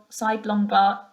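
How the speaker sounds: plain reading voice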